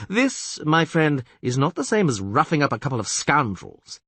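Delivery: blustering castellan. Acted. Castellan warning the witcher.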